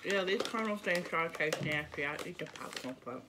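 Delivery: talking through food; chewing while talking. Real, not acted. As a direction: mouth partly full. Seen 1: Left speaker grimaces, jaw working after eating snack, holds bag.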